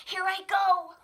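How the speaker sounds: high, loud eager cub, not whispered; Sam's voice. Acted. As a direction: brave little cub setting off. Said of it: "whispered Sam".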